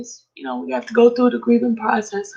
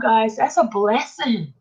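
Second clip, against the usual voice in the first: ordinary delivery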